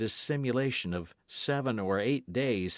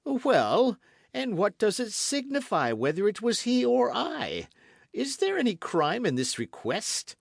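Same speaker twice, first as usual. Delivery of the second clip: much higher, louder defiant retort; blustering king; 13 semitones higher, 5 dB louder than usual. A narrator's reading. King blustering at the queen, defiant. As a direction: turn it back on her.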